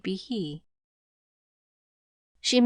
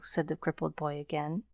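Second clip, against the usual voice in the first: plain reading voice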